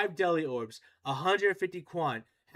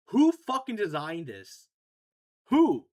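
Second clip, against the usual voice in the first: louder exasperated rant, the last "Who?" jumping much higher; frustrated outburst; 4 semitones higher, 8 dB louder, slower than usual. Real, not acted. Streamer raging at the game designers, loud exasperated yell. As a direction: yell at whoever made this.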